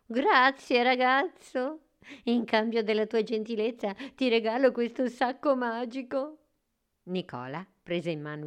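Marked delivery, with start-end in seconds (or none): 0.0-6.4 high little elf voice; kindly fairy-tale elf presenting a gift; grateful smiling elf. A narrator's reading. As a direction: give thanks with a smile, pitched up. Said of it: "sorrise con gratitudine e disse".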